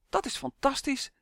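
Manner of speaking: high-pitched cry of delight; delighted exclamation. A narrator's reading. Elf hears the swamp can be dried, high delighted cry. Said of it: "riep Uf".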